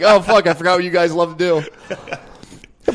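telling his friend the Irish story and laughing; laughing sarcasm. Retold or imitated. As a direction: crack up while being sarcastic. Laughing through retold sarcasm.

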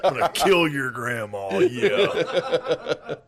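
laughing killer impression.

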